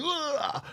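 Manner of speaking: a sharp, high, quick "ugh"; mock grunt. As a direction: squeak out an annoyed kid's grunt. Kid grunts during the argument.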